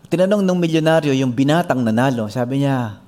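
usual speaking voice

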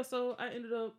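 ordinary talk, nothing marked

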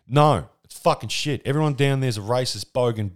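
normal talking voice

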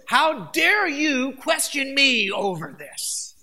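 loud, slow, indignant challenge; how dare you, thundered slowly; stern demanding God. Pretend. Preacher voicing an angry God confronting Cain, loud and slow. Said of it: "that's the way I would come".

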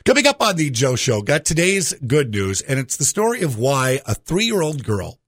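normal talking voice

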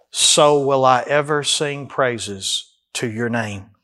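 slow, deliberate So will I; preacher slowly declaring the vow; slow declaration. Acted.

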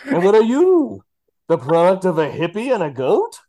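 high, loud menacing villain voice; Bane impression. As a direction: sneer it like a hammy Bane. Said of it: "I am gonna do the impression".

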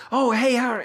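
high, bright replayed scene entry; actor reentering. Actors redoing a flubbed scene, high mock greeting. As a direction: replay the actor walking back in. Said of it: "they'll come back in".

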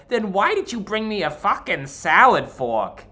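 a touch of an irritated diner snapping; lightly voiced angry customer; mock rude customer. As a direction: throw the complaint at the waiter. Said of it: "she said".

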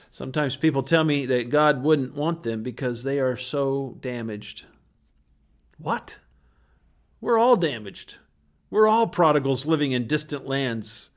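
ordinary delivery

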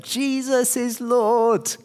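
high, slow proclaiming call; retold shout. Runner calling to cheering churchgoers, high slow shout. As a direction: call it out across the road. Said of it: "And I shouted".

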